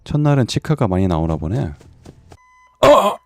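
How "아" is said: panicking gamer. Real, not acted. Startled during horror game.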